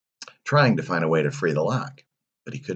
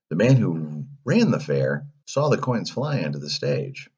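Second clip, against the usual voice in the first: ordinary delivery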